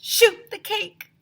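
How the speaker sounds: very high, loud, slow yell; frantic yell; shouting. A narrator's reading. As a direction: holler it. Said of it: "Somebody shouted".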